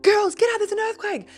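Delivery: high, loud alarmed shout; panicked yell. Retold or imitated. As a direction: wake her in a panic. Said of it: "literally having a meltdown".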